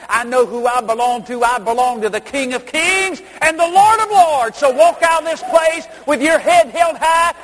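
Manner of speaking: loud, high, building sermon cry; rally the men like a revival preacher; preaching at full force. Acted. Preacher urges the men to walk out bold, shouting high.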